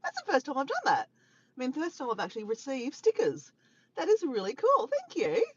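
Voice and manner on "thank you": usual speaking voice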